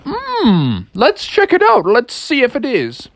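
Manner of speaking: high, musing storybook father; pondering dad. Acted. Dad considers son's camping spot, musing. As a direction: weigh it up with a big hum. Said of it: "And his dad said".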